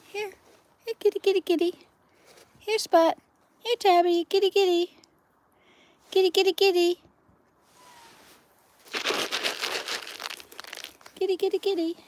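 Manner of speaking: high, loud calling out to the cats; loud high call to the strays at feeding time; loud kitty call. Real, not acted. Loudly calling cats to eat.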